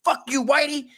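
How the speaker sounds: hammed-up angry drunk yelling back; retelling the attacker's threats, mock angry. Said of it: "he'd come over and be like".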